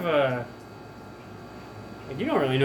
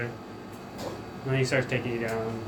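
ordinary talk, nothing marked

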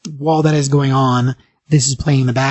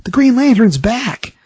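excited bar patron, pitched up; super excited; 7 semitones higher, slower than usual. Acted. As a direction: celebrate great news, voice climbing higher. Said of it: "they're all super excited".

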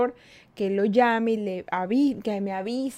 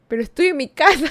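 higher and louder, pleading the obvious; exasperated protest; 7 semitones higher, 10 dB louder than usual. Retold or imitated. The woman protests loudly to her ex that she is home.

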